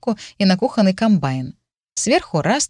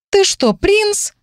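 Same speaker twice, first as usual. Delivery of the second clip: shocked; 3 dB louder, much slower than usual. Acted. Sudden realization.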